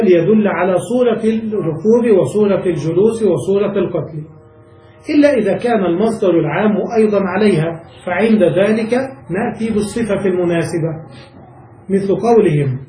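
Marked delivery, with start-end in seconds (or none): none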